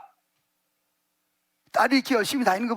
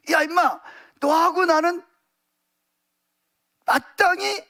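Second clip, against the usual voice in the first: higher, emphatic scolding; rebuking robber; 5 semitones higher, slower than usual. Acted. One crucified robber to the other, rebuking. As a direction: pull him up short, voice raised in pitch.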